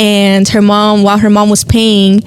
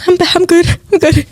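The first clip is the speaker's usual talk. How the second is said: bright put-on I'm fine, pitched up; forced cheerful; 8 semitones higher, faster than usual. Nail tech hiding tears from clients, forced cheer. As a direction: pretend everything is fine. Faking fine between sobbing.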